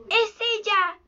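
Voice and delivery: high-pitched cry at normal volume; excited cry. A narrator's reading. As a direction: call out the discovery, voice lifted high. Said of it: "gritó".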